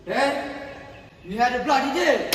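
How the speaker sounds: furious at the broken deal; angry shout. Acted. To Mister Selby over the broken deal, an angry shout. Betrayed, confronting.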